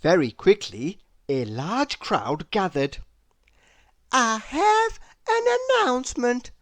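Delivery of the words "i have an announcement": high, grand announcement; announcing. A narrator's reading. Miss Trouble announcing to the gathered crowd, high and grand. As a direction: proclaim it to the crowd. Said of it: "announced little Miss Trouble".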